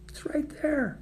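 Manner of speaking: high whispered squeak; playful high whisper; squeaky whisper. Real, not acted. Pointing out the phone.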